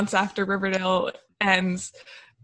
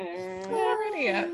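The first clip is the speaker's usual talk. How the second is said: mock Veronica voice; 10 semitones higher, 6 dB quieter than usual. Acting Veronica's reply to Chad.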